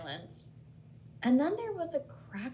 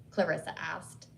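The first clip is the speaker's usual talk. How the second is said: usual speaking voice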